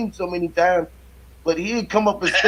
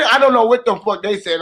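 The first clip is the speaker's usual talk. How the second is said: usual speaking voice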